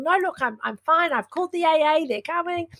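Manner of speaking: put-on American accent. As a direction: imitate an American reassuring passers-by. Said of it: "The accent's gone".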